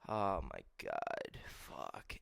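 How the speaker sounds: low, slow, fading sigh of dismay; dejected muttered curse. Real, not acted. Vlogger dreading Wednesdays without good food, quietly dejected. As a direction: curse softly to yourself.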